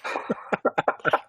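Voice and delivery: quiet breathy laugh; a little unvoiced chuckle; soft laugh. Real, not acted. Laughs at the line.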